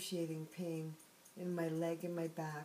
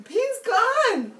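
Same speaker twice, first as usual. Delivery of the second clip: joyful; 16 dB louder than usual. Real, not acted. Rejoicing that pain left.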